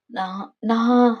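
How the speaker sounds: slow, halting, searching for an answer; hesitant stammer. Acted. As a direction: falter and draw it out. Wife lost for an answer. (தயங்கித் தயங்கி மெதுவாக)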